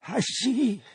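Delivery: put-on an old miser seeing his own fate, rising dread; shuddering Scrooge. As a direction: Scrooge to the spirit, horrified and pleading. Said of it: "shuddering from head to foot".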